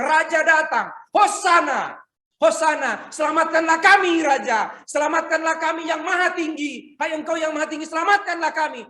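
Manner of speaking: high, loud cries of Hosana; crowd crying out. Acted. Crowd with palms hailing the king, loud high cries. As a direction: call out like a jubilant crowd.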